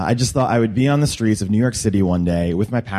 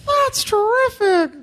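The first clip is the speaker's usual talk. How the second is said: Big Bird voice; slower than usual. Retold or imitated. Big Bird's reply.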